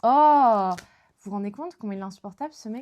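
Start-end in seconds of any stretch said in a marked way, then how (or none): 0.0-0.7 loud, high indignant "Oh!"; exasperated oh. Real, not acted. Irritated at being corrected again, loud exclamation. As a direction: burst out at the nitpicking friend.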